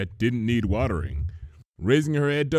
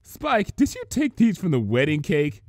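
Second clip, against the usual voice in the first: amused; 5 semitones higher, 4 dB louder than usual. Acted. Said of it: "her tone more amused than sharp".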